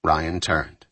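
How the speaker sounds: plain reading voice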